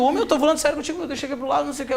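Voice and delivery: high-pitched, anxious insistence, acted out; comic jittery plea; nervous pleading. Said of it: "E eu nervoso, cagado".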